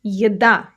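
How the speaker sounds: е-ДА, weight on the final syllable; stressed second syllable. Shown as a demonstration. Teacher showing where stress falls, second syllable stressed in Еда. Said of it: "The stress is on the second syllable".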